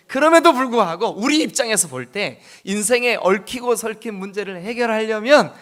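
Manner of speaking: loud preaching push; preaching emphasis. Real, not acted. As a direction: drive the point home. Preaching build-up.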